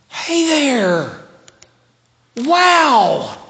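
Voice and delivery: high, drawn-out, loud hail; joyful calling out. Shown as a demonstration. Spotting a dear friend unseen for years, joyful call. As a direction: call across to a long-lost friend. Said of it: "calling out, spotting a dear friend".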